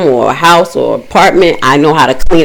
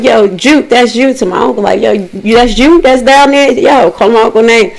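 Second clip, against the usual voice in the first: higher-pitched call downstairs; mock calling to someone below; retold calling out; 6 semitones higher than usual. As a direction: check who is down there, calling out.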